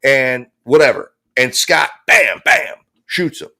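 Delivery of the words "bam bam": shouted bam bam like gunfire; loud mock gunshots; gunshot noises. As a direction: mimic two shots. Gunshots imitated.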